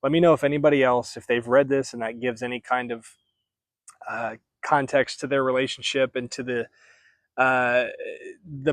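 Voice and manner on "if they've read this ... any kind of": ordinary talk, nothing marked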